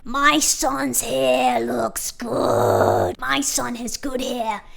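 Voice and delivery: slow, drawn-out put-on American dad; lightly voiced annoyed father; dad defending son's hair, slow and mock-stern; pissed-off dad. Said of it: "The dad's getting pissed off".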